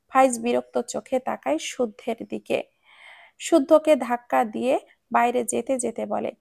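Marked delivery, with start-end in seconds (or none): none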